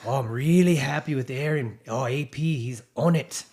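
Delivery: amateur imitation of a South African accent. Retelling the team boss's praise of his rider, mock South African accent. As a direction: put on your best South African, pleased. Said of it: "this is my best South African accent".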